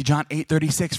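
ordinary talk

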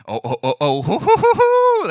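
mock fight hype whoops. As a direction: whoop like teens squaring up for a fight. High schoolers squaring up.

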